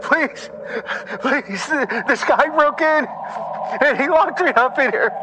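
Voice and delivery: high, panicked begging; terrified pleading. Acted. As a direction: beg breathlessly for rescue. Trapped Josh begging.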